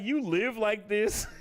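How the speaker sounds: drawn-out shocked question at the messy apartment; Jiraiya's disbelief. Retold or imitated. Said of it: "He's just like".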